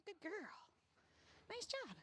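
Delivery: high, lilting praise; high dog praise. Real, not acted. Trainer praising the dog on the walk, high and lilting. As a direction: lift it up for the dog.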